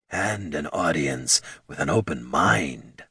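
low, slow wise elder; calm old mentor voice; old sage voice. A narrator's reading. Wise one again.

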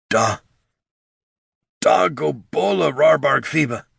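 choked, breaking voice; words catching in the throat; voice cracking. A narrator's reading. Brother choking out words in horror, voice cracking. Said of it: "his voice cracking and choked".

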